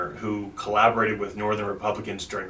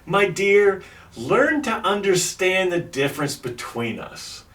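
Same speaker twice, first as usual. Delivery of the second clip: dramatic letter reading; 3 semitones higher, slower than usual. Retold or imitated. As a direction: read it like a heartfelt letter.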